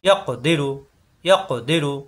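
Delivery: ইয়াক দিরু with ক bounced before দিরু; qalqalah bounce. Shown as a demonstration. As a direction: release the ক of ইয়াক with a short echo. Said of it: "বাড়ি খাবে".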